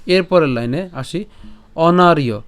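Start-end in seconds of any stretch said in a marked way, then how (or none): 1.8-2.4 অনার্য said loudly and distinctly, ending ইয়; clear pronunciation. Shown as a demonstration. Teacher announcing the next word loudly to the class. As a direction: ring out অনার্য like ওনারিও. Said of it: "কেন অনার্য?".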